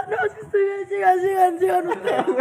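high, loud, humiliated wail retold for laughs; mock tearful. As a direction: wave the others on in a hammed-up crying voice. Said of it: "Estaba llorando, loco. Estaba tan humillado". (imitando llanto, agudo y exagerado)

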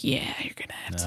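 soft unvoiced whisper; hushed regretful whisper. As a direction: breathe it out barely voiced. Whispered retold reply.

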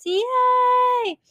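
high squealed yay; excited cheer. Real, not acted. Cheering podcast start.

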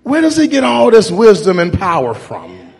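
loud, high preaching. Acted. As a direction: preach it with rising fervor. Retelling text in full preaching.

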